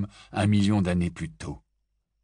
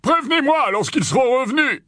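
firm, louder official voice; Kingsley's voice; 7 dB louder than usual. Acted. Kingsley tells the group he must leave, firm and loud. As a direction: announce your departure with authority, loud.

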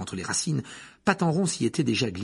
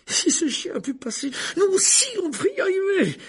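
breathless, urgent high voice; out of breath; 8 dB louder than usual. Acted. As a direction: gasp it out between efforts. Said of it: "le souffle court".

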